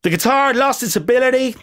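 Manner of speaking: put-on failed American accent drifting Irish; accidental Irish accent. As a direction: try for American, land on Irish. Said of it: "that sounds more Irish".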